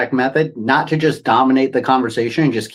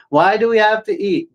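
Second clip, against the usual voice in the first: put-on little kid asking why; 8 semitones higher than usual. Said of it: "like you're a little kid".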